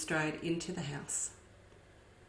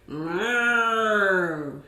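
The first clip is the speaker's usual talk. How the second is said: louder cautious cow moo; 7 dB louder than usual. A narrator's reading. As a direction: answer with a wary moo. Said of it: "answered Belinda".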